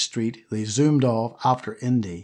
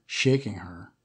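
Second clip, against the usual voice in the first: usual speaking voice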